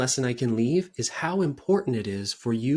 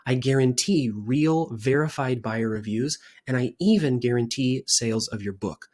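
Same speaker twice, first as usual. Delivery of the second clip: ordinary talk, nothing marked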